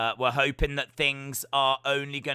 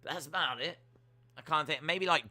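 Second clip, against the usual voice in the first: usual speaking voice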